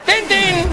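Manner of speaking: high, loud ding-ding; high bell imitation. Drunk rings the bus bell, high bell sound. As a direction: ring it out like a bell.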